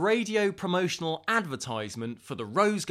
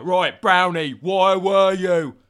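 loud, higher, drawn-out mock interrogation; bad-cop voice; 4 semitones higher, 10 dB louder, slower than usual. Grilling Mister Brown, loud put-on bad-cop voice. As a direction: belt it out as a hammed-up bad cop. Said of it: "Why are you doing that voice?".

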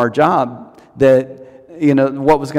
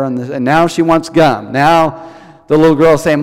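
usual speaking voice

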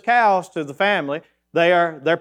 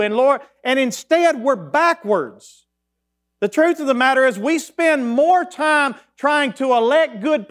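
fired-up preaching; 8 semitones higher than usual. Acted. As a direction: preach it to the congregation with growing fervour. Preaching build.

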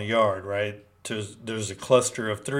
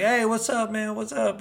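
put-on high-pitched hail to an old acquaintance; high, friendly shout-out without much extra volume; excited greeting; 12 semitones higher than usual. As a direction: greet them eagerly, pitch way up. Retold greeting from fellow inmates.

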